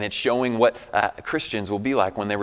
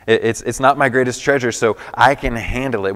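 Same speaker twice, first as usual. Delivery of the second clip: louder, stress on not; emphatic repeat; 9 dB louder than usual. Real, not acted. Pastor driving the point home to the congregation, louder. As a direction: hit not hard and preach it out. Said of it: "repeated louder right after".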